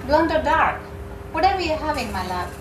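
a hint of the husband's sweetness. A narrator's reading. Husband's line quoted again.

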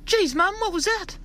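put-on very high child voice; small boy asking mum in the car; young boy. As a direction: squeak it like a startled little kid. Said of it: "And the boy goes".

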